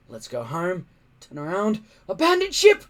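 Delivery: builds from nervous to a high, loud panicked yell; rising panic. Acted. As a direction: spiral into alarm until the last words burst out.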